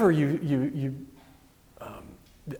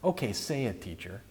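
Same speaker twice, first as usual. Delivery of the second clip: understated sarcasm, dropping softer at the end; dry mock deference, trailing low; sarcastic; 3 semitones lower, 8 dB quieter than usual. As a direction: quietly humour him with a touch of sarcasm. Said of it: "I can almost hear the sarcasm".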